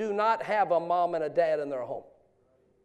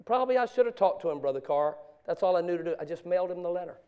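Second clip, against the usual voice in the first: ordinary delivery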